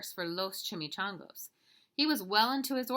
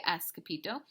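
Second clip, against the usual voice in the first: plain reading voice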